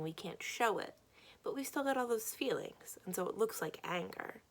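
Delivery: ordinary delivery, nothing marked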